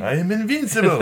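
high, loud triumphant catchphrase; Boris. Acted. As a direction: crow it like a gloating movie villain. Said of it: "what does he always say?".

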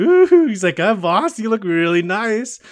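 high, loud, drawn-out flattery; mock swooning compliment through laughter; admiring coo. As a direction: gush over the boss, hammed up. Acting Majima flattering dressed-up Daigo.

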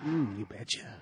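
low, soft seductive purr; a hint of bedroom voice; sultry murmur. Mock seductive replies.

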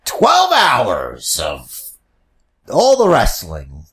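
slow, drawn-out hype announcer; event announcer. Pretend. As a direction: announce it like a huge live event. Mock WrestleMania broadcast opening.

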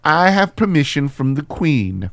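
posh British gadget master; haughty upper-class Q. Acted. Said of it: "I'm Q".

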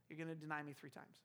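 low, soft, sober reply; hushed acted verdict after big promises; quiet, grave prediction. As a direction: let it land softly and gravely. Jesus' grave reply to the vow.

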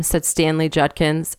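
plain reading voice

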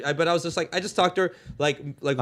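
usual speaking voice

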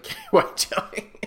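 breaking into laughter mid-sentence; real laugh running through the words. Real, not acted. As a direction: crack up while getting the words out. Cracking up at a gross idea.

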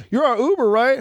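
high-pitched hopeful checking question; imitated expectant passenger. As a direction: mimic a stranger sure you're the ride. Voices mistaken stranger asking.

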